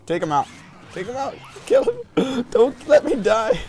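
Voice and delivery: high, loud frantic yell, building; panicked yelling. Real, not acted. Gamer to teammates mid-fight as they nearly die, panicked yelling. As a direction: scream orders as you nearly die.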